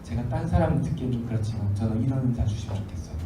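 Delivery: low, soft murmured request; hushed private prayer. Acted. Believer privately asking the Lord for a gift, hushed. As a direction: confide it quietly, as a secret. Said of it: "주님 앞에 소곤소곤 이야기하세요".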